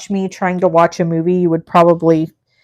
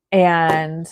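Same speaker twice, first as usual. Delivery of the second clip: usual speaking voice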